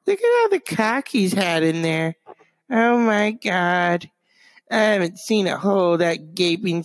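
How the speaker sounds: Truman Capote impression. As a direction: play a catty Truman Capote dishing dirt. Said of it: "Even Truman Capote looked at Lindsey Graham and said".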